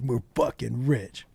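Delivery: slow, low and quiet glee; hushed excitement. Retold or imitated. Retelling how excited the safe thieves were, hushed excitement. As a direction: draw it out softly, relishing it. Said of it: "they were so excited".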